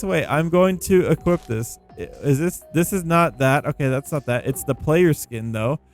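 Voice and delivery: ordinary talk, nothing marked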